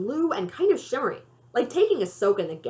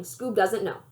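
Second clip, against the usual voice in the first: ordinary delivery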